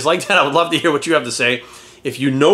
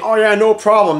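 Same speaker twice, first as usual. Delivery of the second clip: higher, louder breezy "Oh yeah, no problem"; mock eager yes-man; 5 semitones higher, 4 dB louder than usual. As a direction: fake confidence, brushing it off. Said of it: "than you saying".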